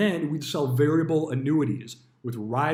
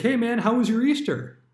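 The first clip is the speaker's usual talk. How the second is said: much higher, falsely cheerful greeting; fake friendly boss; 10 semitones higher, 5 dB louder than usual. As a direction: sing it out like a boss who wants something. Manager's fake friendly Easter greeting.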